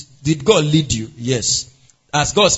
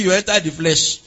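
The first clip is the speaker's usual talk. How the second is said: usual speaking voice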